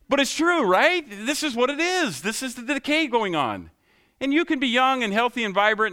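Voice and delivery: fervent pulpit climax; full-force preaching. Acted. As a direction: drive it home at the top of the voice. Preaching peak on decay.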